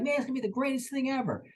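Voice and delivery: high, fast burst of hype; Mike's hype. Retold or imitated. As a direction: pitch it way up and rush the excitement. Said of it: "Mike could be like".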